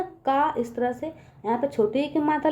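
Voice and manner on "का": का drawn out with an open mouth; का as a slow, long "kaa". Shown as a demonstration. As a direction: open up the vowel in का, slowly. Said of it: "तो मुंह खुलेगा ना".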